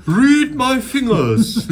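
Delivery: high, slow hammed-up Trump; mock Trump voice. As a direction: overblown Trump impression. Mocking Trump in English.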